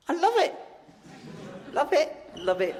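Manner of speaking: gushing. Real, not acted.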